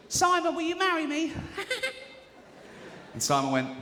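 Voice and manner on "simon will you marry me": very high, laughing mock proposal; giddy fan. Woman in the audience proposing to Simon, very high giddy laugh. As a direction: squeal it like a smitten fan.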